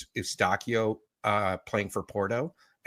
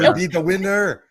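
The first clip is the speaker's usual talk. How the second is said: loud, higher, laughing mock French-Austrian accent; 7 semitones higher, 6 dB louder than usual. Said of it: "a mix of [name] and [name]".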